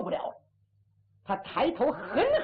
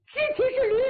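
high, emphatic jab; taunting insult; 13 semitones higher, 5 dB louder, faster than usual. Acted. Chess player hurling couplet at Hu Dahai, high taunt. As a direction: throw the line at him high and hard. Insult thrown back in quarrel.

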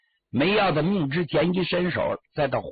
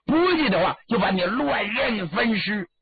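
high, forceful threat to a cornered man; storyteller's villain issuing an ultimatum; menacing threat; 5 semitones higher than usual. Acted. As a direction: threaten him, pitch jumping up at the start.

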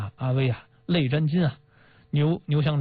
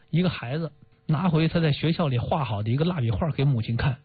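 ordinary delivery

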